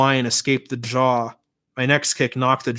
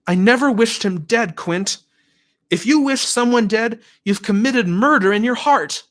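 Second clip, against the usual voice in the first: high, loud pleading conviction; shaken earnest girl; 9 semitones higher, 4 dB louder than usual. A narrator's reading. Vanessa defending herself to Quint, high earnest insistence. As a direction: insist with religious fervour.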